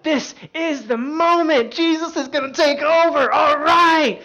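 high, loud, drawn-out war cry; battle-ready shout. Retold or imitated. Peter ready to fight for Jesus, exultant shout. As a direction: act out yelling that the big moment is here. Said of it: "He was ready. He was like".

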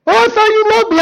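very high, hammed-up wail; guilt-trip him in a high put-on voice; mock wounded pleading. Delilah guilt-trips Samson, mock wounded pleading.

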